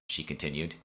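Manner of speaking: ordinary delivery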